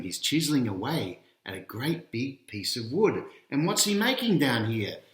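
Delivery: ordinary delivery, nothing marked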